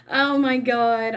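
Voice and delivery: ordinary talk, nothing marked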